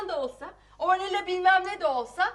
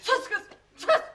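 high, raised-voice command; angry silencing; 5 semitones higher, 4 dB louder than usual. Acted. Mother silences interfering daughter, high angry command. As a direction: cut her off sharply.

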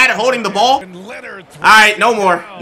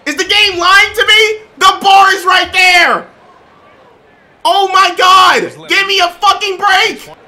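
frustrated yelling; 7 semitones higher than usual. Real, not acted. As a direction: wail at the game that won't let you dunk. Keeps failing dunks in game.

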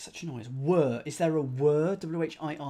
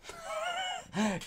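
high-pitched giggle; high laugh; 4 dB quieter than usual. Real, not acted. Solver giggling after getting the clue, high pitched.

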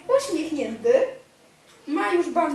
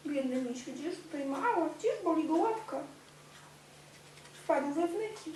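soft, hushed sympathy for the poor bear; softer, gentle aww voice; tender pity; 2 semitones higher, 7 dB quieter than usual. Real, not acted. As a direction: coo over the hurt teddy. Pities bear to toddler.

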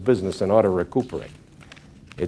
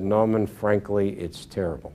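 tearful; 2 semitones lower, 7 dB quieter than usual. Retold or imitated. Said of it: "his eyes welled with tears".

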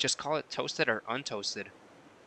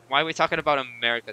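1 exasperated; 2 semitones higher, 4 dB louder, faster than usual. Real, not acted.

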